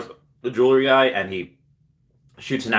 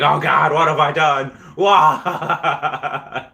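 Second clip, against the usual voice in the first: much higher and louder mock panic, breaking into a laugh; hammed-up dismay; 7 semitones higher, 6 dB louder, much slower than usual. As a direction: overplay the horror, then crack up laughing. Retold cartoonish accident scene.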